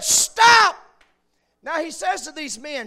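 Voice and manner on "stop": high, loud cry of stop; a single shouted stop from the pulpit. Retold or imitated. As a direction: yell stop with full force. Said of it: "And he said".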